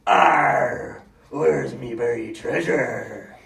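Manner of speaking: low, slow growling pirate. Retold or imitated. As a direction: play the pirate like his son does. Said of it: "He plays pirate all the time".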